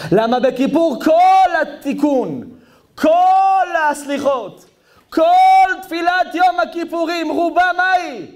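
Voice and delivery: high, drawn-out impassioned proclamation; full-force preaching. Real, not acted. Preacher explaining what Yom Kippur prayers are, full-force preaching. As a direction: build high, stretching each "all".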